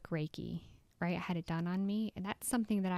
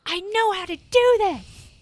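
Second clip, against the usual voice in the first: much higher and louder, slow and certain; fierce conviction; 12 semitones higher, 13 dB louder than usual. Retold or imitated. Sure she can make the bowl sing, loud and certain. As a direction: declare it from the gut.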